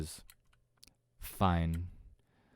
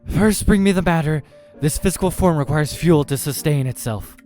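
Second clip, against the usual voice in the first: loud, high command; imperious creature shouting for food; demanding demon; 9 semitones higher, 12 dB louder than usual. Acted. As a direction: thunder the order at the server. Demon slams fist, demands food.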